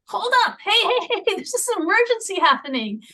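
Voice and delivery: high, loud alarmed hey hey hey; urgent call for attention. Retold or imitated. Parent warning a crowd about an emergency, high urgent call. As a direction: shout to get everyone's attention. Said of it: "It's like".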